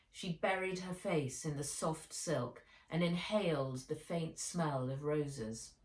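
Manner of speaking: ordinary delivery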